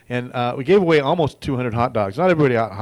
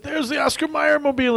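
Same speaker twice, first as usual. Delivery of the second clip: high, eager shout-out of the sighting; excited exclamation; 14 semitones higher than usual. Retold or imitated. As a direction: point and call it out excitedly. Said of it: "somebody said".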